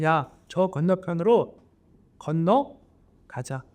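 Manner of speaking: drawn-out, unhurried, steady; slow, calm command. Acted. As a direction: let it land slowly, like a sure promise. Jesus tells disciples to cross. (느긋하게 천천히 확신 있게 말하기)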